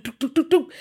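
high mock footstep thuds, doop doop; imitated footsteps. Host mimicking the killer's approaching footsteps, high doop sounds. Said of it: "all you hear is the footsteps go".

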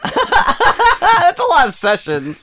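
slow, high, laughing through it. Real, not acted. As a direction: laugh as you say it. Laughing at the idea.

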